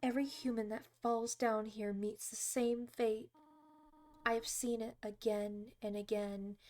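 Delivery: soft, measured character reading; motherly game character. Acted. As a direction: read it gently, like a grave guardian. Reads game character's dialogue aloud.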